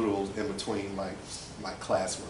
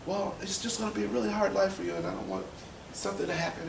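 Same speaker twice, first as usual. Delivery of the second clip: high, softening imitation of a mother; worried mom; 11 semitones higher, faster than usual.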